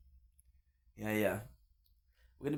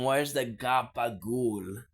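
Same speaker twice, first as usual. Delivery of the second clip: slow, louder New Jersey Italian "gabagool"; mock Italian-American; 4 dB louder, slower than usual. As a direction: ham up a Sopranos-style mobster. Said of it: "You tell them".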